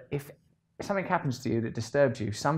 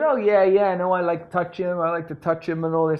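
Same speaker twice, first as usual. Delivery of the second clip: high, loud silly voice of the dad; a goofy high impression of the dad; imitating the dad; 8 semitones higher, 7 dB louder than usual. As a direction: play the hands-on dad, pitched up and eager. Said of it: "he goes".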